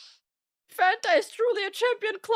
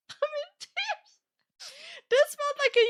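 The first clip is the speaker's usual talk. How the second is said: squeaky-high words squeezed through laughter; helpless laughter; 10 semitones higher than usual. Real, not acted. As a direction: crack up mid-sentence, voice shooting up. Cracks up over the story.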